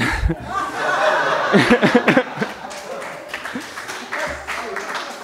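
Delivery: long, soft voiceless laughter. Real, not acted. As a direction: let it roll quietly. Laughs at the punchline.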